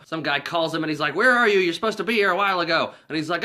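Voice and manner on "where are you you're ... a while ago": loud, high, rushed scolding; mock an impatient caller, fast and loud; imitated annoyed caller.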